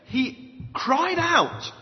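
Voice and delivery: "cried out" drawn out slowly and pitched high; very emphatic. Shown as a demonstration. Preacher correcting 'prayed' to what scripture says, "cried out" stressed high and slow. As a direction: lean hard and long on "cried out". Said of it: "That's very emphatic".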